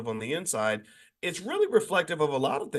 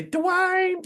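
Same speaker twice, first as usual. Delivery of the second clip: high, sing-song call of a name; Whitley Gilbert impression. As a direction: call out Dwayne the way Whitley would. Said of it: "remember how she talked".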